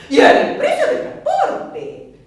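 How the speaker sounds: high, loud and slow, with a laugh; a wailing cry over the price; dramatic dread. Acted. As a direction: moan out the cost like a disaster. Dreading the cost of transport.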